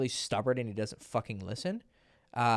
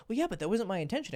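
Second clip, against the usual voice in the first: high, fast mock whine; hammed-up defensive whine; whiny client; 9 semitones higher, faster than usual. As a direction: squeak out the excuse like a sulking kid. Client objecting in retold argument.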